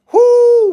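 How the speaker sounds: high whoop; loud whoop. Real, not acted. Recalling the pills after she got shot, loud whoop.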